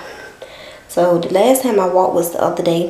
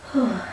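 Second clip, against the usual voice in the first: tired exhale. Real, not acted. Exhaling after exercise.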